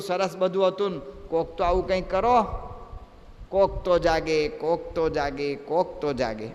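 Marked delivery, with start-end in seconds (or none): 3.5-6.5 recited poem fading out; fading recitation. Acted. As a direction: let the verse die away. Refrain fading lower and softer.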